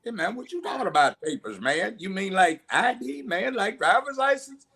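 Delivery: quick, rising, incredulous questions; baffled civilian. Acted. Man stopped and asked for papers, baffled. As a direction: play a man baffled by the officer.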